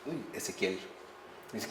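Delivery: ordinary talk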